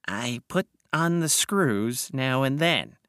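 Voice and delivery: slow, drawn-out character voice; unhurried, peculiar old schemer; sly blackmailer. Acted. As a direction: explain it slowly in an odd little voice. Wilde's character voice explains ledger.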